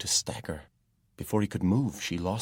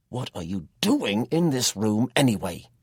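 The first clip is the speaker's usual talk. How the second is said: higher, louder, slow demand; persistent anger; 5 semitones higher, 8 dB louder than usual. A narrator's reading. Jack keeps demanding why Tom was in the room, angry. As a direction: press him hard for an answer. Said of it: "Jack persisted".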